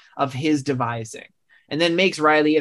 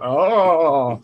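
mock manly scream, drawn out and louder; 5 dB louder than usual. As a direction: let out a hammed-up manly yell. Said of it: "a good manly scream".

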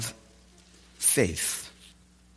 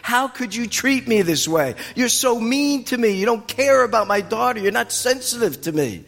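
higher-pitched, hurt protest; indignant complaint; 7 semitones higher than usual. Acted. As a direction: complain as if badly mistreated. Acts indignant complaint she doesn't make.

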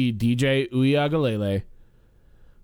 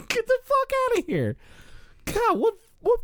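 incredulous scoff. Real, not acted. Incredulous outburst at committee logic.

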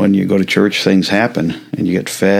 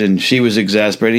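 ordinary delivery

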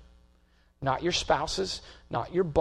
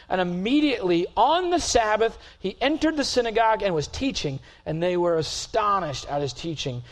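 higher, louder preaching that keeps climbing; full-force preaching; 5 semitones higher, 4 dB louder than usual. Real, not acted. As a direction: drive the scripture home to the congregation.

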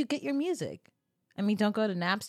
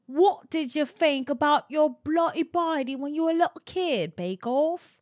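mock British accent; 5 semitones higher than usual. Addressing British partner jokingly.

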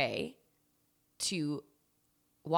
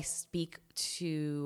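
whisper; 4 dB quieter than usual. Real, not acted. Aside about friend Colin.

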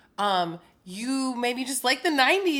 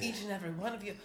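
sultry murmur; 2 semitones lower, 13 dB quieter, much faster than usual. Acted. Sultry voice to listeners.

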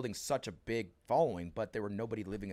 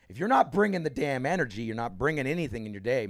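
louder, punchy coach's rallying cry; loud hype quote; 4 semitones higher, 9 dB louder than usual. Retold or imitated. Retelling Tim Grover's opening line to the crowd, loud rallying call. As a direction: pump the room up like a fired-up coach.

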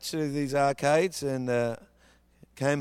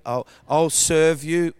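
louder, slow earnest plea; pleading bargain; 7 dB louder, slower than usual. Retold or imitated. Boy in the bedroom bargaining with God, slow loud plea. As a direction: promise it desperately to God. I'm saying.

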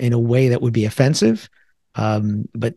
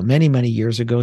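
ordinary talk

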